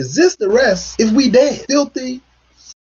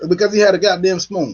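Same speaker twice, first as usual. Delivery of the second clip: ordinary talk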